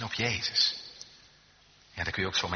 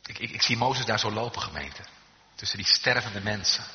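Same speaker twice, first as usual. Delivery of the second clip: ordinary talk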